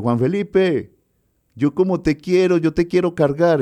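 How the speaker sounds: put-on high, affectionate old man's voice; imitating an old musician. Percussionist tells young host he wants to hold him, high fond voice. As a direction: play the old percussionist fondly teasing.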